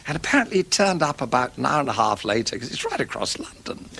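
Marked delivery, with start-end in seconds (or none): 2.6-3.9 hushed aside to the listener; confiding whisper. Real, not acted. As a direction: whisper it under the breath.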